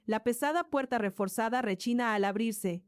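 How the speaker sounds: plain reading voice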